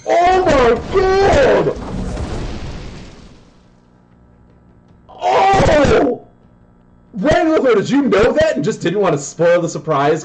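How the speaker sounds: high-pitched, loud, stunned shout; real shock at a wild combo; excited yelling. Real, not acted. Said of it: "Sorry, I am yelling super loud. I'm super excited".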